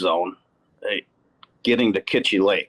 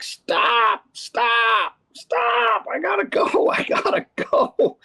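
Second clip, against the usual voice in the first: shrill, much higher panicked yells of stop, trailing into a laugh; frantic yelling; 13 semitones higher, much slower than usual. Retold or imitated. Co-angler begging to stop the boat, frantic high shouting. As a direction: yell it like you can't hold it another second.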